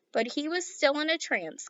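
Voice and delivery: usual speaking voice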